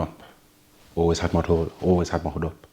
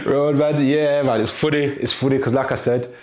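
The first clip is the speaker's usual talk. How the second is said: higher and louder, still laughing at the label; laughing while talking; 8 semitones higher, 7 dB louder than usual. Real, not acted. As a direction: talk through the end of a laugh.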